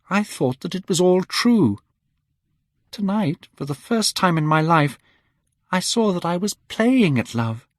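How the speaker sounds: put-on high, earnest young woman's voice; young actress pleading. A narrator's reading. Sybil begging Dorian to take her away, fervent and high. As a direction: plead your love fervently.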